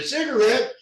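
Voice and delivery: high, incredulous disbelief; shocked. Retold or imitated. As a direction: squeak it out in disbelief. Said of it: "And I'm like".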